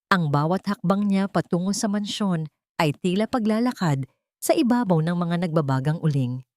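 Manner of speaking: ordinary delivery, nothing marked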